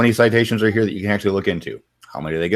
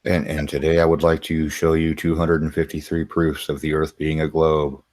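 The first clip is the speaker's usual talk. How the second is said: droning announcement held on one note; monotone voice; 3 semitones lower than usual. Shown as a demonstration. Redoing the globe proofs intro as a joke, in a monotone voice. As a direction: present it in a dead-level monotone. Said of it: "I didn't do the monotone voice".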